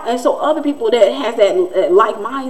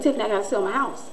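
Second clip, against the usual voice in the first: softer, quick imitated confession; confiding neighbor; 2 semitones lower, 8 dB quieter, much faster than usual. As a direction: drop the volume as if confiding.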